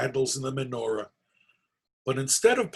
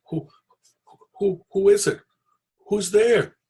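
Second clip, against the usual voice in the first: louder, higher startled stammer; frightened stammer; 7 semitones higher, 6 dB louder than usual. Acted. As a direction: stumble over the words in fright. Startled man in the dark.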